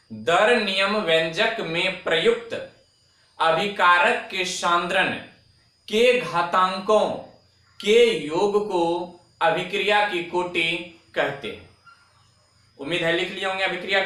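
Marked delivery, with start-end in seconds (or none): 0.1-11.7 deliberate chunks with a pause after each; slow dictation. Shown as a demonstration. Teacher giving the definition once more to students, slow dictation. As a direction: dictate carefully, leaving time to write. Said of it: "धीमे धीमे बोल रहा हूं".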